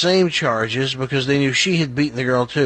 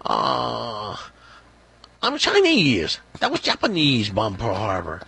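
mock Chinese accent. Punched Chinese guy correcting his attacker, mock Chinese accent. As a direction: play an indignant mock-Chinese protest. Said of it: "And he goes".

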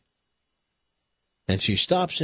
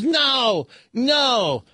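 mock-frantic boy, much higher and a bit louder; lightly voiced son breaking down; hysterical son; 12 semitones higher, 4 dB louder than usual. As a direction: blurt the punchline in a high panic. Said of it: "and he is hysterical".

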